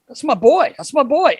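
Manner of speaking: trainer's high, excited boast; mock excited trainer. Medical guy telling the young kid about his idol, high and excited. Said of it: "He goes".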